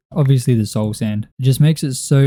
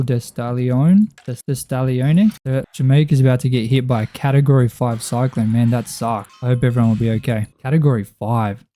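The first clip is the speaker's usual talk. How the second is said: ordinary delivery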